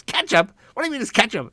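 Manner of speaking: high, loud outraged yell; furious disbelief, shout it. Retold or imitated. Bumped driver learns it's ketchup, yells in outrage. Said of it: "the guy starts yelling at me".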